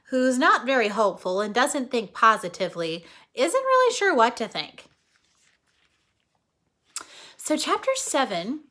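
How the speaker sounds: ordinary delivery, nothing marked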